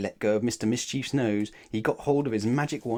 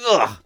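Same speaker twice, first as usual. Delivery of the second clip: disgusted ugh; 10 dB louder than usual. Acted. Greedy bites mud cake.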